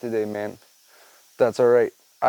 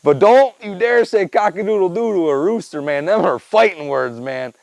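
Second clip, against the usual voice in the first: usual speaking voice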